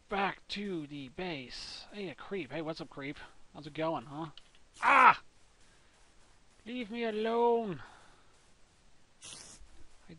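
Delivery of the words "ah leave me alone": a loud, high yelp then a slow plea; hammed-up fright, high; mock scared cry. Gamer startled by a creeper, mock scared yelp.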